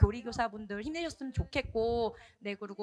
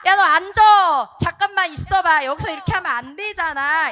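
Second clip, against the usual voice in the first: high, very loud acted telling-off; scolding shout; 6 semitones higher, 15 dB louder than usual. Teacher ordering a disobedient child to sit, scolding shout. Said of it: "말 안 들으면 누구나 한 번씩".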